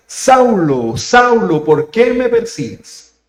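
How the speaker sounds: calling out loud and high, drawn out; a commanding heavenly voice calling a name. Acted. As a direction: call down from heaven, slow and emphatic. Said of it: "oyó una voz que le decía".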